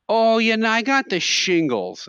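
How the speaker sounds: put-on high, slow complaining old lady; old lady customer. Old lady telling grocery clerk her ailment, high drawn-out voice. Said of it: "these old ladies would come in".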